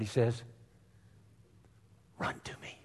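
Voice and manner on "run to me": unvoiced, much softer whisper of God; whispered call. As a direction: breathe the invitation. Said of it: "you know what God says?".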